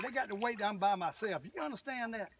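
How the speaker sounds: normal talking voice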